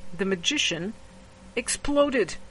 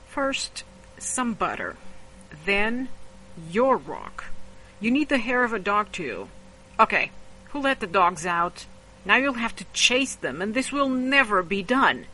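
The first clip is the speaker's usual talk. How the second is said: brisk, impatient magician instructions; politely impatient; 4 semitones higher, 5 dB louder than usual. Acted. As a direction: hurry the lesson along, mildly annoyed. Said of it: "the magician said impatiently".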